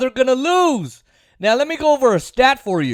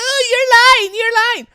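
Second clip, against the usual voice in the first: much higher, whiny mock protest; mocking liberal friends; 14 semitones higher, 5 dB louder than usual. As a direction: parody their dismissive whine. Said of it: "my liberal friends".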